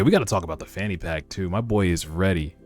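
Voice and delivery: ordinary talk, nothing marked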